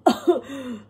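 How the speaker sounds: a sharp demonstrated gasp for air; choking gasp. Shown as a demonstration. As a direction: gulp for air like a choking burglar. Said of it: "choking and gasping".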